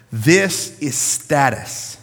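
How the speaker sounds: This is status, very slow and weighty; emphatic. Real, not acted. Preacher hammering the point to the congregation, slow and emphatic. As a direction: land every word of This is status slowly.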